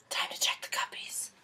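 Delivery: hushed whisper; whispering before checking the cupcakes. Real, not acted. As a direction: sneak it out like a secret.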